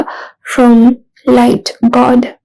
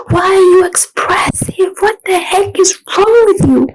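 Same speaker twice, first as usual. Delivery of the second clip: higher, contemptuous put-on voice; mock them from above, pitched up; shrill mocking critic; 7 semitones higher than usual. Voicing a harsh inner critic.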